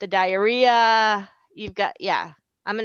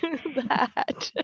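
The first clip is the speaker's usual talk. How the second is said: laughing; 4 semitones higher, 3 dB louder than usual. Real, not acted. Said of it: "What did you say?".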